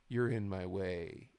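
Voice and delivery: low, slow mock menace; imitating a solemn wrestler promo; slow trash talk. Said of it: "slow talking back and forth".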